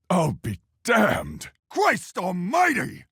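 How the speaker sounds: high, drawn-out cry of amazement; stunned, stretched-out oath, pitched high; astonished exclamation. Acted. Astonished exclamations among character voice lines.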